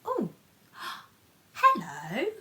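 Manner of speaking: ordinary delivery, nothing marked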